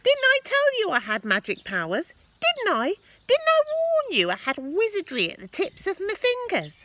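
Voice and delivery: put-on high, loud gloating old woman; grandma bragging loudly from the roof; boastful Grandma. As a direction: crow about your magic powers. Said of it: "she answered".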